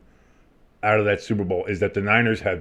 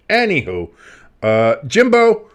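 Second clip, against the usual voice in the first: usual speaking voice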